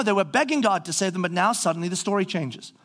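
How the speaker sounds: ordinary delivery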